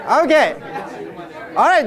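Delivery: usual speaking voice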